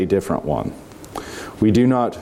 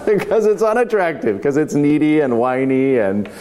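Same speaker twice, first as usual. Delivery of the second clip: laughing; 8 semitones higher than usual. Real, not acted. Laughs at the needy man.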